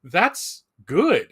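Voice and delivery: normal talking voice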